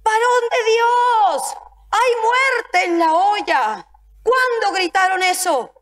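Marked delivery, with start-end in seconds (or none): 0.0-3.9 alarmed cry. Retold or imitated. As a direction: call out in dread across the table. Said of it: "gritaron diciendo".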